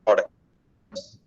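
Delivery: garbled, dropping call audio; bad connection. Real, not acted. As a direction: signal cutting out mid-word. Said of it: "it's still breaking up quite a bit".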